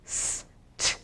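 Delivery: "S-t" as separate sounds, s then t; sounding out words. Shown as a demonstration. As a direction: whisper "S-t" sound by sound. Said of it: "when I give you the two sounds".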